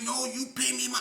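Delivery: high squeaky chant of no, no, no; insistent old man. Retold or imitated. Old man refusing to wait for his money, high squeaky insisting. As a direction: keep it high and pushy. Little man demands pay.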